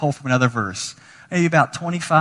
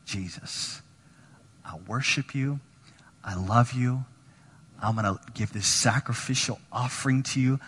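hushed devotion; 3 semitones lower, 7 dB quieter than usual. Retold or imitated. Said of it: "she's like".

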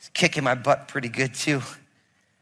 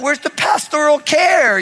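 mock indignant whine; 10 semitones higher, 6 dB louder than usual. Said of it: "I stood up, I'm like".

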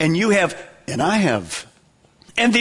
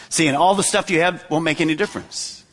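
ordinary talk

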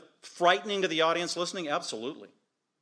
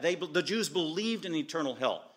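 ordinary delivery

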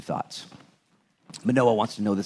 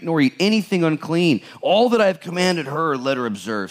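raised, emphatic Bible reading voice; scripture reading; 8 semitones higher than usual. Acted. Pastor reading Judges to the church, emphatic reading voice. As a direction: proclaim the verses to the congregation. Reads verses twelve to fourteen.

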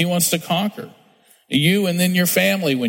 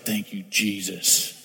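softer, slow, half-laughing thanks; relieved murmur; 5 semitones lower, 6 dB quieter, slower than usual. Retold or imitated. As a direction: breathe out relief with a little laugh. Relieved nobody opened the door.

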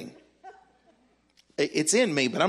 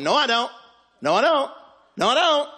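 higher-pitched, protesting re-enacted argument; insistent refusal; 9 semitones higher, 4 dB louder than usual. Arguing out loud with God in the hallway, insistent. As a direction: dig in and protest, pitch climbing.

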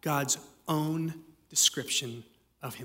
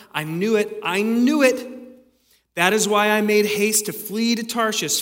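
loud, higher, exasperated Jonah who saw it coming; 4 semitones higher, 7 dB louder than usual. Acted. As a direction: pastor voicing Jonah railing at God, loud exasperated outburst. Preacher voices Jonah's angry complaint.

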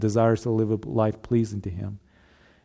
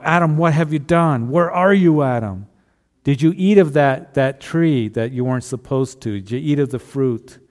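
loud, higher stern demand; mock angry voice; 4 semitones higher, 5 dB louder than usual. Pastor mimicking an angry God calling Adam, loud and harsh. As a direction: call out Adam like a furious judge. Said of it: "speaking to Adam in an angry voice".

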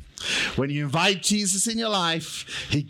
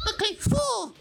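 very high squeaky voice; small squeaky answer; squeaky puppet voice. Pretend. Puppet countdown.

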